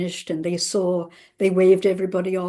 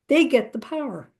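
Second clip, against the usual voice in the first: ordinary talk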